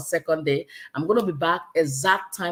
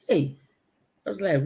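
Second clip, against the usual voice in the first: ordinary delivery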